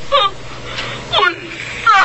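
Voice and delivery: high crying "what?"; shocked cry. Acted. Regina, abandoned by her chief, cries out in shock. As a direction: disbelief breaking into a cry.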